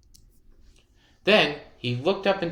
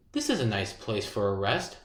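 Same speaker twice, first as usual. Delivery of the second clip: softer, lower, to himself; quiet thought; 6 semitones lower, 6 dB quieter than usual. A narrator's reading. Peddler tells himself under the tree, softly. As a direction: murmur it like a tired man. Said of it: "thought he".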